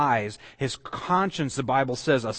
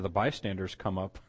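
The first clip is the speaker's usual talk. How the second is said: ordinary talk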